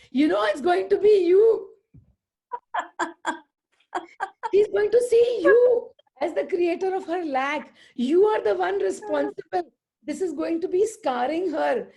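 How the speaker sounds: loud, high mock scolding; retold outburst. Friend warns aunty she'll scar the kid, loud mock reproach. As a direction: raise pitch and volume, laughing partway through.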